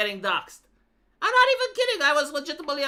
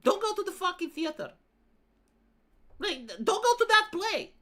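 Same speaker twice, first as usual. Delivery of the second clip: high-pitched, hammed-up alarm; mock horror warning; 9 semitones higher than usual. Mocking the narrator's horror talk about theater, mock horror warning. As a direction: warn them off, pitched high in mock dread. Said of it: "talking as if it's a horror".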